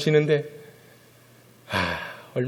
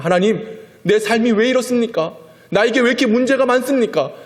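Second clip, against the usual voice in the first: much higher, louder lament; complaining to God; 9 semitones higher, 5 dB louder than usual. Retold or imitated. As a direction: cry out why in frustration. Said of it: "항변하고 원망할 수 있단".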